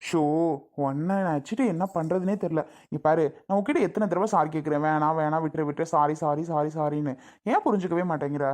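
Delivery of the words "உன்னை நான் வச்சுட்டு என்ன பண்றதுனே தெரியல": ordinary delivery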